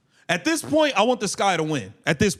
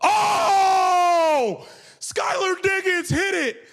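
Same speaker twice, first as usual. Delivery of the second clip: anguished shout; 9 semitones higher, much slower than usual. Real, not acted. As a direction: cry out as the rival shot drops. Rival hits shot, live reaction.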